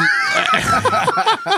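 shrieking burst of laughter, very high; a high laugh bursting out at his own joke. Real, not acted. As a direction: crack up with a piercing high laugh.

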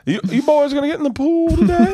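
very high mock Carolina older-man voice; Southern buddy's dad. Buddy's dad asks the boys about swimming, high mock Southern accent. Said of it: "was that guy".

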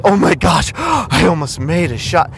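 gasp, then high shout of disbelief; hammed-up shocked gasp and cry; mock gasping player.

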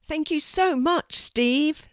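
high, bright-pitched thanks; high, eager chorus-style thank you; grateful kids calling. A narrator's reading. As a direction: call out thanks like excited children. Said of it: "the cousins shouted together".

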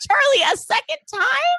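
very high-pitched incredulous question; mock astonishment. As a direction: squeal it in feigned disbelief. Mock shock at face reveal.

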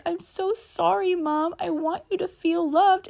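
put-on high, small child's voice, apologizing through tears; playing a little boy who is sorry and pleading; young son in tears. Mother voicing her crying youngest son saying sorry, in a high, tearful child voice. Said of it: "my youngest son just burst into tears".

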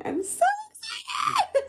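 high, squealing burst of joy; excited squeal. Real, not acted. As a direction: shriek it with delight.

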